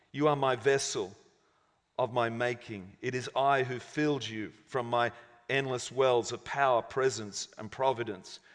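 low, soft divine reassurance; gentle. A narrator's reading. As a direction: settle into a quiet, tender voice. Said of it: "replied God gently".

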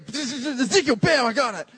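high, loud mock triumph; triumphant finder. Pastor imitating a churchgoer finding Ezekiel, mock triumph. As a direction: snap to the page, proud you found it first. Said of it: "you go".

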